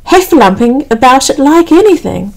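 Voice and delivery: usual speaking voice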